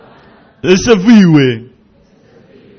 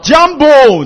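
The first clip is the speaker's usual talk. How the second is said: "Jambo" called loud and high; shouted greeting; 8 semitones higher, 7 dB louder than usual. Shown as a demonstration. Preacher repeating the greeting for the crowd, loud. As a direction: call "Jambo" out loud again. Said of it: "跟我一起喊".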